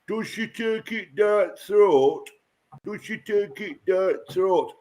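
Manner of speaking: a hammed-up Yorkshire accent, slow and mocking. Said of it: "If you go to Yorkshire".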